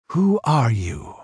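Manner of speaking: slow, sharp demand of a stranger; pirate queen demanding the stranger's name. Acted. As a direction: press him for an answer. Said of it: "she demanded".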